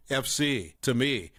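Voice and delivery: plain reading voice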